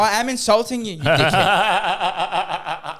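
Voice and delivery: high, loud sneer, dragging out the last words; retold jeer with a laugh at the end; loud mocking insult. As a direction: hammer the insult home. Insulting politician to his face.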